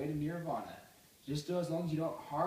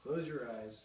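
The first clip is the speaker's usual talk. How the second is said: softer, lower calm meditation guide; guided meditation; 5 semitones lower, 7 dB quieter than usual. Acted. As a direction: ease them gently into stillness.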